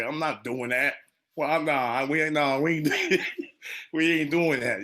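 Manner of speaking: laughing, indignant group objection; mock protest. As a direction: balk at it, half laughing. Acted group refusal, laughing.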